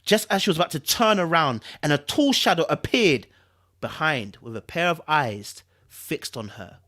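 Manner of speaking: ordinary delivery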